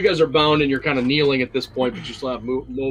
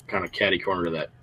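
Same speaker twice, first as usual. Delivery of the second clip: ordinary talk, nothing marked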